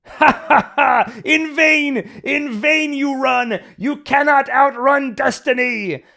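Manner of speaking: high, loud, slow hammed-up villain cackling prophecy; Von Franz mad villain laugh. Von Franz laughing at Thomas, booming a high mad villain taunt. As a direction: gloat like a mad prophet, drawing out each word. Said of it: "Von Franz starts laughing at him".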